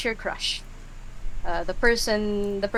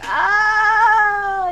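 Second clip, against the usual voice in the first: long high loud ah; panicked scream; 12 semitones higher, 9 dB louder than usual. Acted. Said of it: "start collectively saying".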